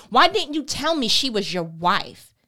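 loud angry Pharaoh; loud angry Pharaoh reading; sharp accusation. A narrator's reading. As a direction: throw him out, loud and cutting. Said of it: "accused him sharply".

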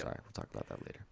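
quick whisper to a friend; hushed side comment; whispered aside. Real, not acted. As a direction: drop to a whisper for a private aside.

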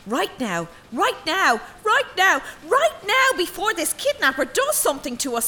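screaming at her to act; panicked shout. Acted. Maid begging her mistress to flee, frantic high shout.

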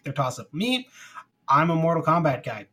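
usual speaking voice

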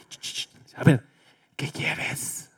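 whispered, reluctant reply; grudging whisper. Retold or imitated. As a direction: hiss it out under your breath, annoyed. Son answering mother grudgingly.

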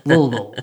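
"Louisville" clipped to Lull-vull like the locals; locals' Lull-vull. Shown as a demonstration. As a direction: swallow "Louisville" into two short syllables, Lull-vull. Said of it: "as if it were L O V E L L".

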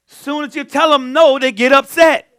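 preaching. Acted. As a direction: lift the congregation with it. Preaching to congregation.

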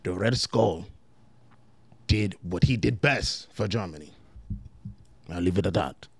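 put-on German man speaking English, understated German accent. German character answering the Brooklyn guy, in a low, understated German accent. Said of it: "You guys fucking with my German accent".

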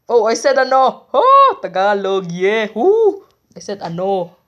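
loud, high and thrilled at slipping into Tagalog; excited. Real, not acted. Host cheering to listeners that she said ano, loud and excited. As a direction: whoop with delight at her own slip.

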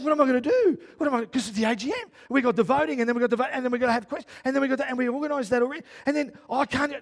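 loud, high, frantic rambling; acted-out panic. Preacher relives realising he double-booked the meeting, panicked. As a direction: replay a meltdown, tumbling from one worry to the next. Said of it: "I'm like, I'm having this moment".

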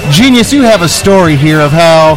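loud show host voice; big live-event announcer. Acted. Loud show host on convention floor.

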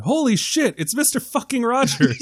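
high excited New York yell; excited New Yorker. Retold or imitated. Passerby spotting Mister Rogers, high excited yell. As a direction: gasp it out like a starstruck fan.

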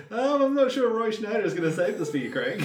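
high, loud mock-sceptical friend; hammed-up doubtful co-host, high and loud; Mike. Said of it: "I can see the look on Mike's face, which is like".

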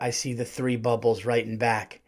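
ordinary delivery

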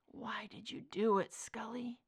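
soft unvoiced whisper. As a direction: breathe the question to her. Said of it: "he'd spoken aloud".

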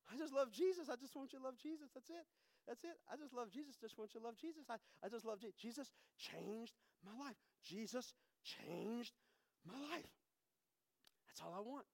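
almost hushed, sincere testimony; soft tender preaching. Real, not acted. Preacher softly telling the congregation he loves Jesus. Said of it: "softly preaching about Jesus".

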